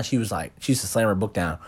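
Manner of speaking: ordinary delivery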